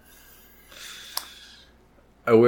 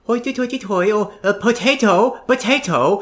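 high-pitched caricature Irish babble; mock Irish accent; 12 semitones higher than usual. People making fun of his Irish roots, mock Irish accent. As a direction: mimic bullies squealing fake Irish, much higher. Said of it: "and they go".

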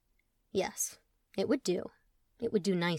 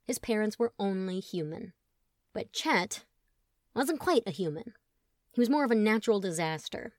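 plain reading voice

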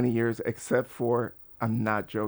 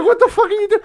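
very high, loud mock disbelief; exasperated outburst; 10 dB louder than usual. Man to the cop on his phone, high exasperated yell. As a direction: yell incredulously at someone slacking.

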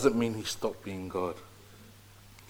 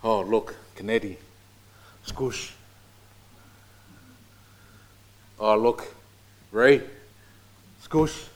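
playful god voice; 4 dB louder than usual. Pretend. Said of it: "Imagine myself being God".

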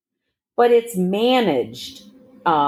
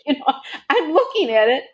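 laughing; 8 semitones higher, faster than usual. Real, not acted. Laughing over the DNA result.

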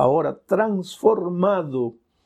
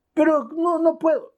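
whining refusal; 13 semitones higher than usual. Acted. Thirsty man protesting.

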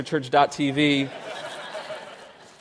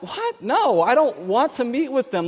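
surprised; 8 semitones higher than usual. Retold or imitated. Refusing the staff request.

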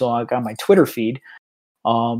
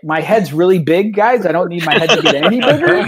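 louder, higher, laughing self-mockery; laughing modesty; 6 semitones higher, 5 dB louder than usual. Organizer telling fans his head is big, laughing. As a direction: joke about your big head. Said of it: "I was like".

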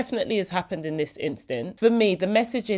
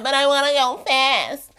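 whiny protest; 6 semitones higher, 4 dB louder than usual. Retold or imitated. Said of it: "I was like".

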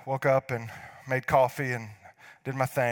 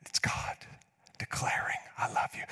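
breathy whispered declaration. Real, not acted. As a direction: whisper it like a secret. Whispered aside.

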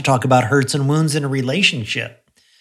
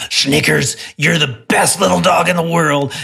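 gruff, louder scolding tone; scowling; 4 semitones higher, 3 dB louder than usual. Retold or imitated. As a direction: growl the compliment like a reprimand. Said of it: "I could scowl and say".

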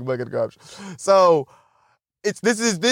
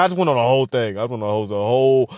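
ordinary talk

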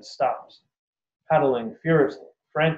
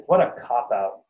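ordinary delivery, nothing marked

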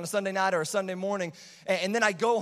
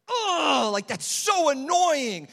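higher, louder, slow exasperated complaint; annoyed groan; 8 semitones higher, 6 dB louder, much slower than usual. Retold or imitated. Tired dad woken at night by the baby, annoyed groan. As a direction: groan it like you've just been woken.

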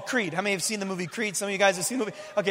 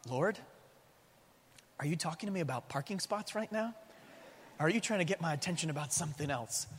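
hushed wonder; 4 semitones lower, 12 dB quieter than usual. Retold or imitated. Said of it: "And I thought".

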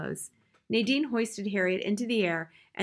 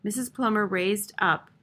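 usual speaking voice

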